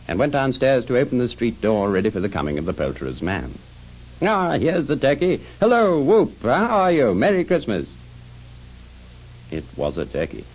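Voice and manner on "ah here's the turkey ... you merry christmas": high, swooping delighted hail; joyous greeting. Acted. Scrooge greeting the turkey's arrival, high joyous whoop. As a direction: greet everyone with whooping glee.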